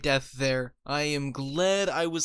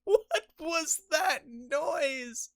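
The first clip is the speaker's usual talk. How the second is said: high, stretched gasp breaking into laughter; real disbelief, laughing hard; laughing in shock; 14 semitones higher, much slower than usual. Real, not acted. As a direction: squeal of laughing amazement. Bursts out laughing at game noise.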